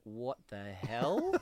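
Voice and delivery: very slow, drawn-out disbelief; slow-motion baffled reaction; mock bafflement. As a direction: stretch every word, utterly confused. Imagined baffled reaction of new viewer.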